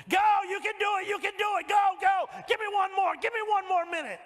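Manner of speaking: gym rat yelling. Retold or imitated. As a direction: bark him on like a gym rat at the treadmill.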